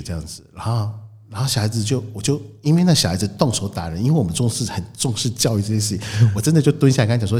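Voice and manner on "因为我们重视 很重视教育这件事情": normal talking voice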